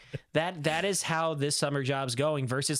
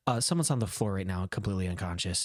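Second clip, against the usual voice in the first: lower, matter-of-fact caller; sudden drop to serious; 7 semitones lower than usual. Retold or imitated. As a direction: drop the cheer as you report it. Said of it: "It immediately went from".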